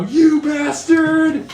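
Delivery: high, loud, drawn-out cry; outraged shout. Acted. Ivanova cursing Strahd in the play, outraged stagey shout. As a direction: yell it at the villain in stage fury.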